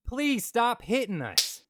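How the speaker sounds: high, loud, drawn-out mock plea; mock pleading. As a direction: beg them slowly, hamming it up.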